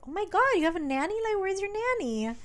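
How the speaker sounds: high, fast gushing exclamation; mock astonishment. Mom hearing her son has a nanny, mock astonished. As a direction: imitate an overexcited, nosy mom squealing.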